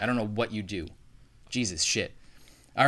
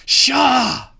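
very loud, much higher Scottish yell; loud Scottish shout; 16 dB louder than usual. Shown as a demonstration. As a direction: belt it out Scottish. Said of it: "the more, like, loud you get, the more Scottish you sound".

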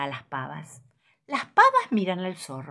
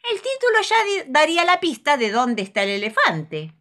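objecting fox; 10 semitones higher, 6 dB louder than usual. Acted. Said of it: "cuestiona el zorro".

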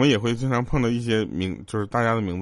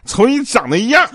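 mock angry jab; 11 semitones higher, 6 dB louder than usual. Quarrel between two colleagues.

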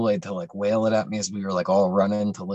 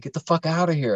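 higher mock yell; 7 semitones higher than usual. Son ordering parents out, retold yell. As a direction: yell them out of the room. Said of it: "I was, like, yelling".

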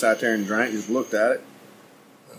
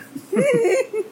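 high burst of laughter; real laugh. Real, not acted.

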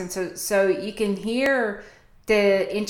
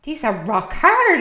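high, louder mock dismay; a lightly voiced, retold surprise; surprised; 7 semitones higher, 6 dB louder than usual. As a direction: act out the shock of rock-hard cookies. Retold surprise at hard cookies.

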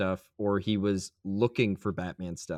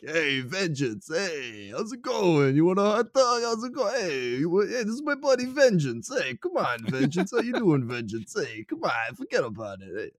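higher-pitched, chummy New York wise guy; 7 semitones higher than usual. Acted. Host riffs on crooks greeting Batman by nickname, hammed-up New York accent. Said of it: "I don't know why I turned him into a New York guy".

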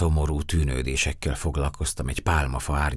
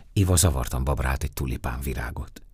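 plain reading voice

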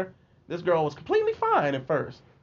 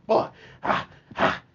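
panting hard after a run; out of breath. Retold or imitated. Kid collapsing by the teacher after outrunning bullies, out of breath. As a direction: gasp out breathless, voiceless puffs. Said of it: "breathing hard".

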